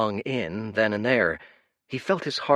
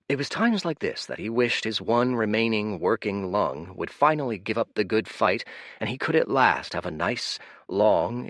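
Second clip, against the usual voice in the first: ordinary delivery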